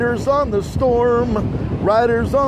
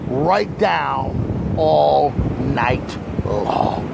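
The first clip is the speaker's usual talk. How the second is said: slow low boast; 7 semitones lower, much slower than usual. Acted. Boasting swagger to hurricane.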